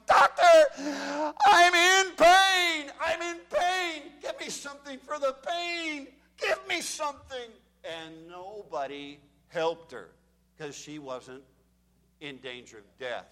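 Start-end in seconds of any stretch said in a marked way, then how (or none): 0.0-7.5 high, drawn-out wailing pleas; slow, high begging for painkillers; patient in the ER begging the doctor for relief, high slow wail; woman screaming in pain. Retold or imitated. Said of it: "Her scream sent chills up my spine".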